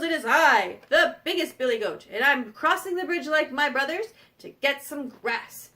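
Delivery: firm big-goat voice; biggest billy goat. Acted. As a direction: announce yourself as the biggest goat. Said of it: "he goes".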